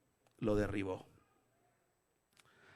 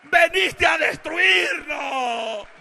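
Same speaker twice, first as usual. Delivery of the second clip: high, very slow loud wail; demon crying out; 11 semitones higher, 10 dB louder, much slower than usual. Acted. Demon to Jesus who came to destroy, crying out. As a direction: draw out the shouted cry. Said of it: "Y dio voces".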